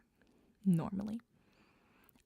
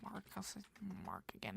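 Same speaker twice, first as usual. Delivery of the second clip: normal talking voice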